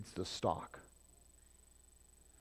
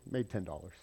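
normal talking voice

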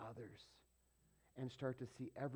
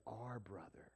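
whisper; 4 semitones lower, 9 dB quieter, slower than usual. Real, not acted. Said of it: "hushed contrast to my brother".

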